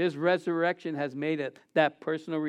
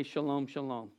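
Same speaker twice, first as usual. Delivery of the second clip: softer "Shalom, shalom"; soft greeting; 4 semitones lower, 10 dB quieter than usual. Shown as a demonstration. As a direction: model for the congregation. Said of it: "look at each other and say".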